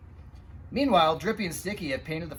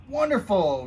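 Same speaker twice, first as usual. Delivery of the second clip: cheerful exclamation; 4 semitones higher, 6 dB louder than usual. Acted. Fire chief greets the painters.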